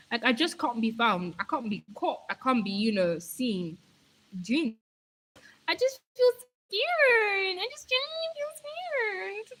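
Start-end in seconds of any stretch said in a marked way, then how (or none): 5.7-9.5 very high squeaky whine; mock scared squeal. Streamer tells viewers that whispering feels scary, high squeal. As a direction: squeak it out, drawn out, playing frightened.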